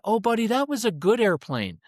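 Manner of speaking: high, cooing parental consolation; well-meaning parent. Acted. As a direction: gush reassurance like a doting parent. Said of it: "all good parents come in".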